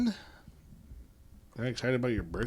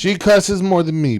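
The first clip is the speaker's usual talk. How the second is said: high, loud objection; indignant, raised-voice comeback; loud protest; 8 semitones higher, 12 dB louder than usual. Real, not acted. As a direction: protest it loudly, pitched up. Protesting what son just said.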